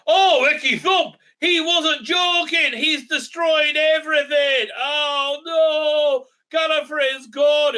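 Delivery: high, drawn-out hammed-up cry of despair; mock horrified wail. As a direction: wail it slowly like a bad melodrama. Mocks Doctor's horror at destroyed Gallifrey.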